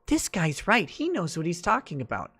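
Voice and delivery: lightly put-on voice of eager agreement; mock Slate readers. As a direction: mildly mimic credulous readers nodding along. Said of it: "Slate readers will eat it up and be like".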